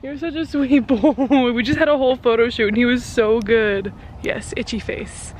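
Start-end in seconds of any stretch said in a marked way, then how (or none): 0.0-1.5 tender praise. Real, not acted. Praising her horse.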